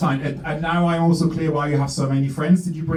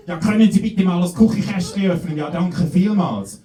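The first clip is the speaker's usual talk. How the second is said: raised, throaty mock Swiss German, slowing at the end; 5 semitones higher than usual. Comedian imitating Swiss German speech for a London crowd. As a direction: parody Swiss German like choking on something. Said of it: "Swiss German sounds very much like".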